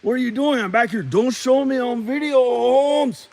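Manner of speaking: loud protest from a skittish stranger; Mexican guy character. Acted. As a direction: call out in a high put-on Mexican accent. Unseen Mexican guy calling out, skit.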